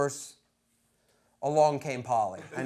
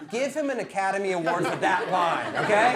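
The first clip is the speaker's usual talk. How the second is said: ordinary delivery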